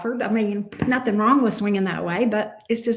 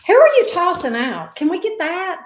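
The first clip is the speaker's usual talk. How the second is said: nosy excitement; 6 semitones higher, 4 dB louder than usual. Real, not acted. Nosy question to viewers.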